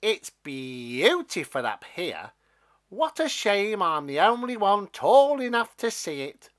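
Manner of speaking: a mean stalk boasting down to Jack; teasing. Acted. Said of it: "it teased".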